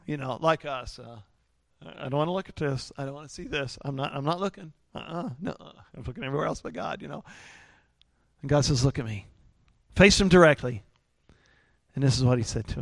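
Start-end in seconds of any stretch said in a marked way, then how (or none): 1.8-7.0 very soft, shrinking refusal; evasive reluctance. Acted. Gideon avoiding the face of God, softly evasive. 8.8-9.3 low, soft command; quiet insistence. Acted. God calling Gideon to face him, quietly firm.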